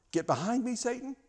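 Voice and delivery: a high, cutting "Get behind me, Satan"; sharp rebuke. Acted. Jesus rebukes his best friend Peter, sharp and high. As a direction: snap it out like a karate chop. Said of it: "That's a karate chop right there".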